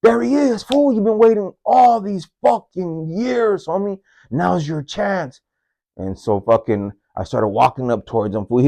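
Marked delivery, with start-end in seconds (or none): 0.0-5.4 high, goading devil voice; devil on my shoulder. Retold or imitated. Little devil whispering from his shoulder, urging revenge in a high voice. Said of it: "the little devil on my shoulder".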